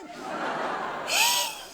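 a sharp, high gasp of horror; high theatrical gasp; shocked gasp. Acted. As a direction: gasp as the penny drops. Shocked realization.

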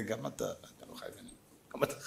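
whisper, playful and sly; whispered quip. Retold or imitated. Rabbi teases the doctor back, whispered. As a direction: whisper the punchline.

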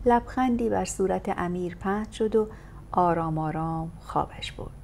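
ordinary delivery, nothing marked

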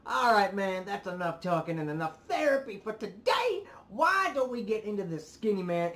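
loud, high-pitched rallying call; hyped segue. Real, not acted. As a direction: shake off the venting and get hyped. Rallying viewers into the video.

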